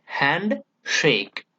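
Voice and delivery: "handshake" slowly split into HAND, SHAKE; stress on both syllables. Shown as a demonstration. Teacher breaks down a spondee, slow equal stresses. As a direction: give "hand" and "shake" separate, equal weight. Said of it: "Both the syllables are very important".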